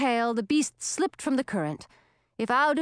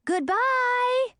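high, chirpy chorus of worms calling; worms calling back; 14 semitones higher, 3 dB louder than usual. Acted. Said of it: "they all called back".

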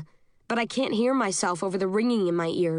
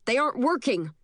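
higher-pitched call, little added volume; mild shout; 4 semitones higher than usual. Acted. Guard reporting on the alarms, mild shout. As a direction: report it urgently in a raised pitch. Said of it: "another guard shouts".

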